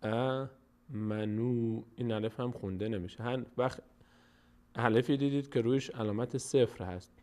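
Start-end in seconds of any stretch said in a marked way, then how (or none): 0.0-1.8 very slow, drawn-out "آمنو"; long-vowel recitation. Shown as a demonstration. Teacher answering how the word reads, very slow recitation.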